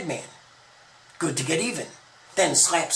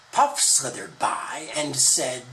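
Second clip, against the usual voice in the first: ordinary delivery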